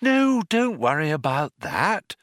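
high sing-song comfort; lilting. A narrator's reading. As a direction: keep up a sweet lilt. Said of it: "the lilt continued".

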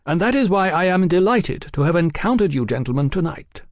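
lightly voiced, genial gentleman; cordial gentleman journalist. A narrator's reading. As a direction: explain yourself with airy cordiality. Oliphant is delighted to meet them.

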